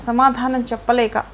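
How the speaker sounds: ordinary delivery, nothing marked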